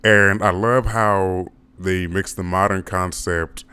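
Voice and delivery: ordinary delivery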